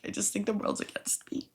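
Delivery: hushed, tearful mock whimper; crying. Recalling crying to the manager, small weepy voice. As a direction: squeak it out softly, imitating tears. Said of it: "sitting there crying, being like".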